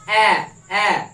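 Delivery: loud, slow, separate "অ্যা" sounds; open-mouthed demonstration. Shown as a demonstration. Teacher proves apple's sound works open-mouthed, loud slow "অ্যা". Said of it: "হাঁ করে উচ্চারণ করতে পারছি কিনা".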